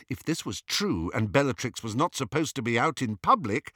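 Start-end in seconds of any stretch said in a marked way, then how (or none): none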